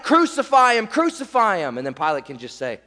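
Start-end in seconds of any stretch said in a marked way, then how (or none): 0.0-1.8 raised crowd chant, easing off; mock scream. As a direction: imitate a mob's demand, falling away. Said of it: "they're gonna scream".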